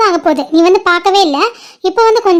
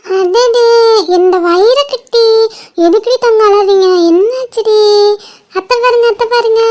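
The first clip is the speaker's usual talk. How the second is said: playful auntie babbling to an infant; cooing baby talk; 3 semitones higher than usual. Acted. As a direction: coo to soothe a fussy little one. Aunt soothing a crying baby.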